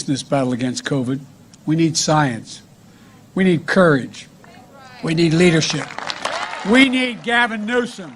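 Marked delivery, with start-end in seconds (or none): none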